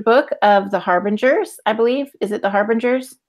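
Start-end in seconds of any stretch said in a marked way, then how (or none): none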